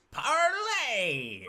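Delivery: high squawking plea; pleading creature voice. Acted. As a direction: beg for mercy in a bird voice. GM voices surrendering Kenku.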